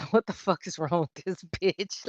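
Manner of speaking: soft, giggling disbelief under the breath; laughing inner thought. Retold or imitated. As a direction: laugh quietly through it. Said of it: "first thing come to mind is".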